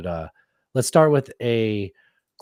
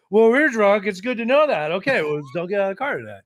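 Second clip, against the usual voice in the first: higher, louder mock drunk reasoning; drunk people; 10 semitones higher, 3 dB louder than usual. Drunks reasoning with each other, mild mock drunk. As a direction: lightly play drunks talking it over. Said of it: "they're like".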